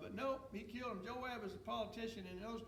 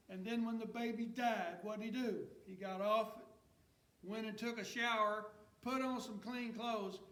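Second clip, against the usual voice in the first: rising, louder preacher's cadence; preaching at full force; 3 semitones higher, 5 dB louder than usual. Acted. Pastor driving the story home to his church, preaching at full force. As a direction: preach it out to the congregation.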